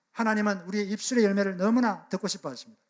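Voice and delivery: ordinary talk, nothing marked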